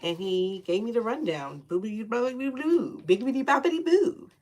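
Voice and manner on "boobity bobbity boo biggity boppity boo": higher, playful spell chant; a light mock witch casting a spell. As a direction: play a silly sing-song incantation. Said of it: "He said he was a gray witch".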